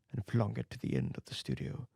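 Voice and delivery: plain reading voice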